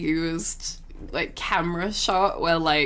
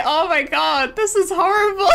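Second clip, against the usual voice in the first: a touch of hammed-up horror, louder and higher; mock dismay; 9 semitones higher, 6 dB louder than usual. Her reaction to the nurse missing the vein, mock dismay. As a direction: exaggerate the pain of the needle.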